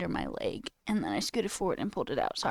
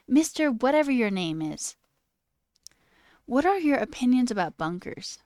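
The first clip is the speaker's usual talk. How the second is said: lightly voiced student, pitched higher; mock-innocent student question, raised pitch; kid baiting the sub; 5 semitones higher, 3 dB louder than usual. Student distracting the substitute with a question, lightly voiced kid. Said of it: "a kid in the class would be like".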